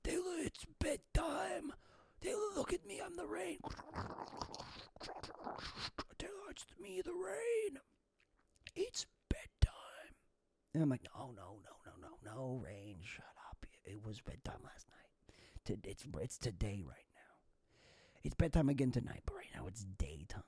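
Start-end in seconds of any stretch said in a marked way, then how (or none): 0.0-10.2 high breathy whisper, coaxing him to bed; a soft high whisper; whispering rain voice. Pretend. 11.2-20.4 low whispered pleading back at the rain; a low hushed no; hushed protest. Pretend. He answers the rain quietly.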